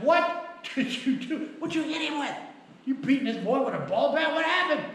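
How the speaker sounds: high-pitched, incredulous dad; mock astonished interrogation; re-enacted alarmed questioning. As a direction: act out a flabbergasted father grilling his son. Re-enacts dad questioning his youngest son.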